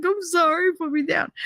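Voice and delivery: put-on boy begging the angry father; scared kid. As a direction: beg like a terrified boy. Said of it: "He was like".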